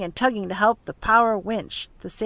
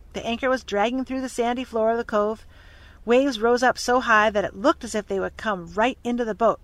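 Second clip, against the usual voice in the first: usual speaking voice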